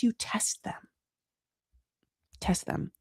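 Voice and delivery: soft whispered aside; conspiratorial whisper. Real, not acted. Host advising listeners to test people, conspiratorial whisper. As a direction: drop to a whisper, as if sharing a secret.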